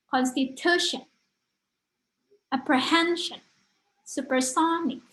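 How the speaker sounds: "Constitution", "apprehension", "supersonic" with the third syllable lifted high and stressed. Shown as a demonstration. Teacher showing students third-syllable stress in English words, with pitch lifted on the stressed syllable. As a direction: give "-tu-", "-hen-", "-so-" a strong pitch jump in each word. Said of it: "berarti tekannya yang ketiga".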